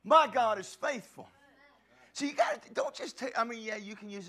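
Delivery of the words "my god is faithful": loud declaration; proclaiming shout. Real, not acted. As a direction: shout it out to the church. Preacher proclaiming to congregation.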